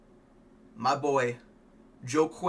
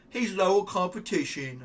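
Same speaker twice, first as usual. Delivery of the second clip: higher, slow put-on voice of a rival fighter; mock Gary voice; 6 semitones higher, 4 dB louder than usual. Mocking the rival fighter Gary's snooty voice. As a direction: sneer it out like the dismissive rival. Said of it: "I can't even do his fucking stupid voice".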